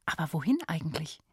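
fast unvoiced whisper. Real, not acted. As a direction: whisper the question quickly.

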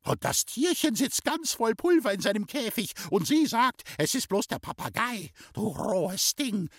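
high, outraged tirade slowing on the insult; indignant screaming. A narrator's reading. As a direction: shriek your outrage as the aunt. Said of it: "schrie die Tante". (empört gekreischt, mit hoher Stimme)

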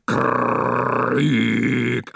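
loud blunt outcry; loud outcry of war; war cry. Acted. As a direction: shout it like a battle cry. Roaring at the motor.

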